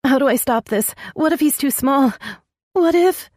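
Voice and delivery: high, wavering and rising in fear. Acted. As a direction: let the voice wobble with fear. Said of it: "Her voice wavered".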